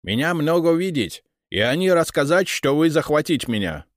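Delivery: high, loud foreigner in broken Russian; an indignant Englishman insisting; English accent Russian. Acted. (английский акцент, возмущённо)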